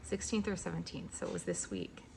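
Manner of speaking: almost a whisper, holding back crying; emotional. Real, not acted. Vlogger tells viewers she is leaving her home, softly close to tears. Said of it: "that's making me even more emotional".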